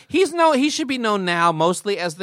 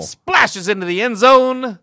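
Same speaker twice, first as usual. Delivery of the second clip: louder, higher, slow announcer flourish; mock touchdown call; 6 semitones higher, 6 dB louder, slower than usual. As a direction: stretch it out like a play-by-play climax. Mock touchdown call using the nickname.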